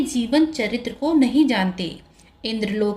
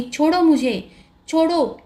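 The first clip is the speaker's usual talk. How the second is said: higher-pitched demand to be released; urgent narrated plea; protesting; 6 semitones higher, 3 dB louder than usual. A narrator's reading. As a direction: protest at being seized, voice raised in pitch. Bound Mohini demands release.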